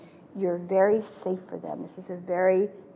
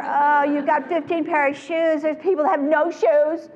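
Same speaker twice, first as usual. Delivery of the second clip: higher, louder mock lecture; lecturing parent; 8 semitones higher, 8 dB louder than usual. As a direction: ham up the guilt-trip lecture. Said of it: "You don't do any of that".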